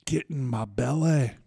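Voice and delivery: slow, drawn-out Scottish glutton; growing hunger, stretched out in a Scottish accent; Fat Bastard. Acted. Co-host does requested movie villain, slow Scottish glutton voice. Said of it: "give me fat bastard from Austin Powers".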